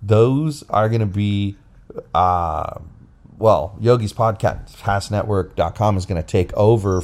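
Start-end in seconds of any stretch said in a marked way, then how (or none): none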